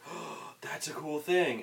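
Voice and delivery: slow, whispery gasp of wonder; mock hushed amazement, drawn out; imitated awed kid. Voices amazed kids.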